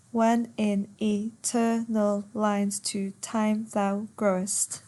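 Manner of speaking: slow, syllable-by-syllable verse reading; per suku kata. Shown as a demonstration. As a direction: count out the line, one beat per syllable. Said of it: "Ten syllables".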